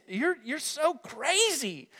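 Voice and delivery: high, slow, drawn-out disbelief; incredulous God. Acted. God to a believer who misses old life, incredulous. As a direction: play God comically baffled by His child.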